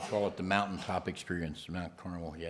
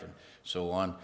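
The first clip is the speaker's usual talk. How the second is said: ordinary talk, nothing marked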